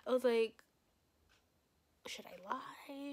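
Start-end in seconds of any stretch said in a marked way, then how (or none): 2.1-3.1 slow whispered aside; hushed, drawn-out wondering; secret thought. Retold or imitated. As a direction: whisper it slowly, as if to yourself. Retold secret thought to herself.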